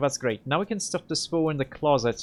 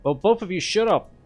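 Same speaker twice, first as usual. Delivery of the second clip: loud, higher, fed-up snap; angry shout; 6 semitones higher, 6 dB louder than usual. Acted. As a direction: shut them both up hard. Ends quarrel between two others.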